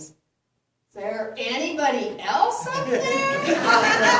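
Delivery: loud, slow, rising punchline yell; acted call upward. Man on the cliff seeking another answer, loud call. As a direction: call out hopefully for a second opinion. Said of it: "pauses and says".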